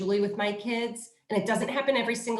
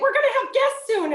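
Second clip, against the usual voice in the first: 高得多、响得多、又快的假装吼叫; 像要冲孩子们吼一样，尖高而快地喊出来; mock yell; 6 dB louder, faster than usual. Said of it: "I was about to yell at them".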